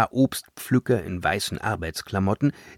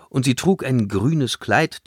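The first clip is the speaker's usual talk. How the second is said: ordinary delivery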